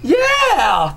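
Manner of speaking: high whooping cheer. Real, not acted. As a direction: whoop it up, delighted. Cheering a good week.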